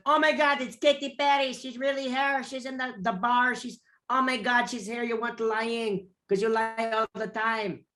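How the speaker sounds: hammed-up Filipino accent, very high; excited Filipino accent. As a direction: imitate a Filipino friend phoning in disbelief. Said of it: "That's my Filipino accent".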